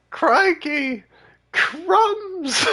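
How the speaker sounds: high-pitched mock British squeal; mimicking British character. Player echoing the game character, high mock British exclamation. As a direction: parrot it high, hammed-up. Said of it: "like, British person".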